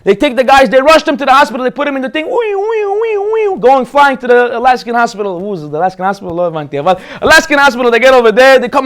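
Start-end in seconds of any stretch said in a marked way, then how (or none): 2.2-3.6 high mock ambulance siren wee wee; siren noise. Storyteller imitates ambulance rushing to hospital, high siren wee-wee.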